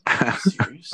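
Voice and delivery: loud breathy whisper of disbelief; incredulous. Retold or imitated. Salesperson reacting to a bad credit score, in a loud stunned whisper. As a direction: gasp it out, stunned.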